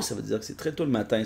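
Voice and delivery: usual speaking voice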